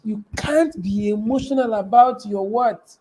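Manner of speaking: usual speaking voice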